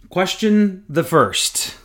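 high, loud and very slow, like a herald; mock formal announcement. Host reading out listener's question. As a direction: announce it grandly as a joke.

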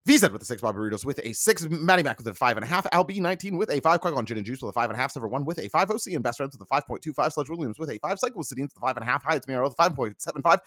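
rapid-fire roll call of scores; quick read-out. Real, not acted. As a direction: rattle off names and scores. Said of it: "I will read those quickly as I can".

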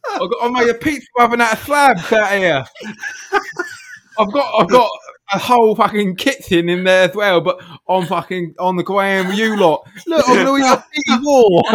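high, loud hammed-up TV chef; mock celebrity chef voice. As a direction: send up a cheerful TV cook. Chef voice continues.

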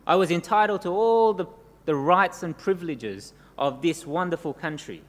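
usual speaking voice

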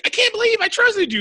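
squeaky, mocking falsetto; mocking high voice. The women scolding Maggie in a mocking falsetto.

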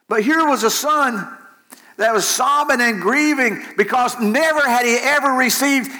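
high, urgent preacher's cry; impassioned preaching. Real, not acted. Preacher pleads for a son who never heard praise, impassioned.

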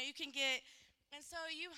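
ordinary talk

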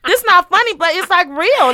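high, loud laughing aside; laughing protest. Real, not acted. Cohost laughs while saying it isn't funny. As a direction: laugh while insisting it's serious.

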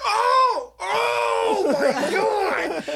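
a whole crowd gasping in horror, hammed up high and slow; shocked crowd. Comedian imitates the horrified audience with a loud high shriek. Audience gasps at his injury.

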